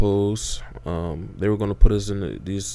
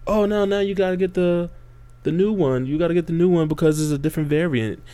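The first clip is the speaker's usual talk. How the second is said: higher-pitched mocking imitation of the officials; 8 semitones higher than usual. Mocking officials who demand the new shot, in a higher sarcastic voice. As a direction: parrot the new rule in a raised, sarcastic voice.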